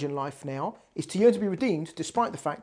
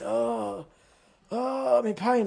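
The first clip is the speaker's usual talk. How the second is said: retold moaning, slightly raised pitch; imitated agonized moan; 5 semitones higher, much slower than usual. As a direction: groan it as if in pain. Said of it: "moaning in agony in the back".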